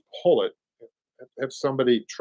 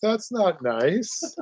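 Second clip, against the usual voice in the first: slow, higher, drawn-out mock scold; 8 semitones higher, much slower than usual. Host teasing his partner with a slow mock scold. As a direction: stretch it out like a playful telling-off.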